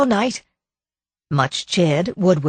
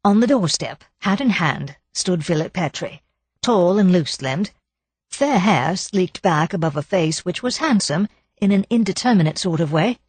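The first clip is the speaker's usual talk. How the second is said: usual speaking voice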